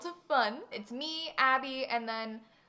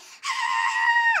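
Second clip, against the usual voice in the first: high, loud hammed-up scream; mock scream; 10 dB louder than usual. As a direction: shriek it like a dramatic patient. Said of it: "this was so dramatic".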